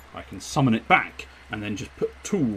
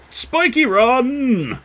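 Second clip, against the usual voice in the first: excited cry; 13 semitones higher, 7 dB louder, much slower than usual. Acted. Proudly naming his machine.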